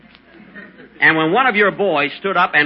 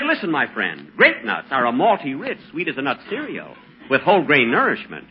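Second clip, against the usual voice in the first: soft ad read; 3 semitones lower, 4 dB quieter than usual. Acted. Ad copy read softly.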